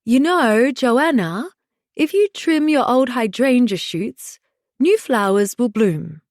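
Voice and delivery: put-on botched Scottish old-lady voice, slipping towards Australian; Marjorie. A narrator's reading. Mother mimicking her elderly neighbor, attempted Scottish accent. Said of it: "I attempt the accent, doing my best impression of the seventy-five-year-old".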